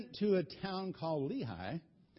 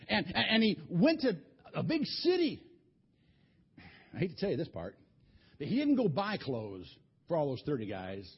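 ordinary delivery

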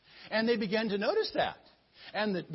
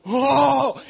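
startled gasp; 6 semitones higher, 13 dB louder than usual. Acted. Wife grabs Joe.

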